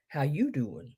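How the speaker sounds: YOU stressed with a swoop, low and soft, in accent; casual accented "how you doin'"; put-on "How you doin'" accent. Shown as a demonstration. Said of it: "my accent there".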